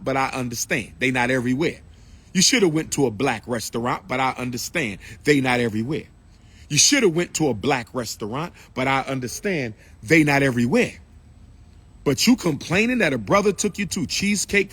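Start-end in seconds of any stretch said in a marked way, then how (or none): none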